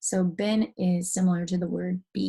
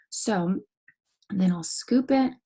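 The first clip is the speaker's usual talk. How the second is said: usual speaking voice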